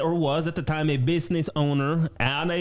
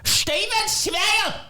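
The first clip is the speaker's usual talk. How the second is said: put-on shouted high with a German accent. Shouting the town name back in a German accent. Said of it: "like some kind of German accent".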